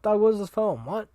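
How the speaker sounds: high, loud startled outburst; shocked. Real, not acted. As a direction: blurt it out in disbelief. Shocked by the poem.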